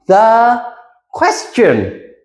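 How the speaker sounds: The question stretched out slow; stress on question. Shown as a demonstration. Teacher repeats the key phrase to the class, stressed. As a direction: draw out question, make it the key word. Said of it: "stress on the question part".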